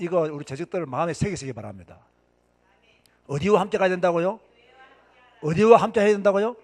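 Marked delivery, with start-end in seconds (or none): 3.3-6.6 loud rallying question to congregation; calling out. Real, not acted. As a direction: demand the answer in a raised voice. 설교자가 회중에게 되묻기. (회중에게 크게 외쳐 묻기)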